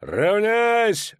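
very high, loud, slow roar; dwarf roars at his ranks; roaring battle cry. A narrator's reading. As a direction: roar the charge at the troops. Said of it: "рычал Барклай Эльс".

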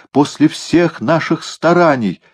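high, slow lament; dismayed. A narrator's reading. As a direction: draw out the dismay. Сокрушается о смерти кота. (сокрушённо, протяжно)

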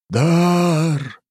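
loud, high booming statue cry; hollow statue voice. Acted. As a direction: boom it out like a living stone idol. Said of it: "повторила глухим голосом статуя".

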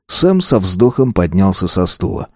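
plain reading voice

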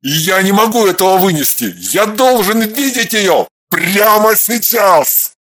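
desperate rage. A narrator's reading. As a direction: push it out through anguish. Husband raging alone.

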